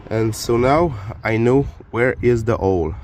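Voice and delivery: normal talking voice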